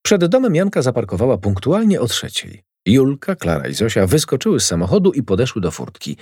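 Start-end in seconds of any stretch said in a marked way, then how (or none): none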